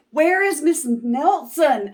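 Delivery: put-on high, loud worried children's question; kids asking. Kids asking the new teacher, high and worried. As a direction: ask it like anxious kids. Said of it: "asked the kids".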